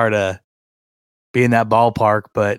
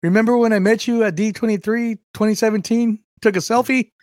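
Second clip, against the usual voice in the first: much higher gushing fan voice; mock fan running up for a selfie; eager fan; 10 semitones higher than usual. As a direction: gush at him like a starstruck fan. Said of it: "'cause I'm like".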